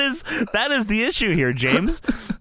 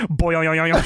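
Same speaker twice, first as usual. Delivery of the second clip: lower, springy cartoon sound effect; imitated boing; 5 semitones lower, much faster than usual. The lights go out, imitated boing sound. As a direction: bounce it out like a spring. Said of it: "and then you hear".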